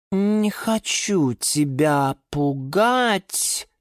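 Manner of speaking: hesitant, pensive delivery with long pauses; thoughtful. A narrator's reading. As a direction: stretch each word as if still pondering. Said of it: "задумчиво сказала Лиана Диана".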